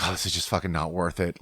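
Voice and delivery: resigned grumble. Retold or imitated. Surfer giving up on the argument, resigned grumble. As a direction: drop pitch and volume, giving up. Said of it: "But I get mad".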